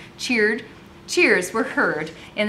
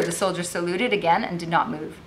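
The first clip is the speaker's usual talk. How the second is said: usual speaking voice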